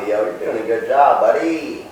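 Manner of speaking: ordinary delivery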